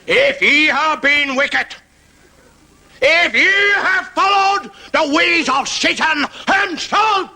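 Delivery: high roaring hellfire preacher; Paisley preaching. Retold or imitated. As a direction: draw out each threat of sin. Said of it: "and he talks like that".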